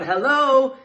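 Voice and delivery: loud, high pastor's call of hello; loud church greeting. Shown as a demonstration. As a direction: call it out big to the congregation. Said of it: "that word come up really loud".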